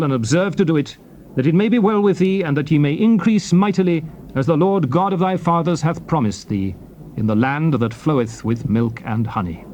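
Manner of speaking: ordinary delivery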